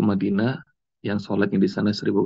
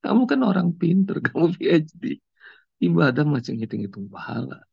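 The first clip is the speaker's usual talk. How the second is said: pitched-up, lightly voiced stranger with a laugh; stranger's advice; 7 semitones higher than usual. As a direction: retell his gentle scolding, a bit amused. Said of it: "dan dia tahu-tahuan lagi".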